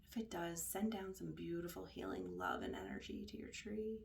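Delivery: soft, hushed meditation voice, trailing into near-whisper; quiet, unhurried guidance; guided meditation. Acted. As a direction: lead them softly, with long pauses between phrases.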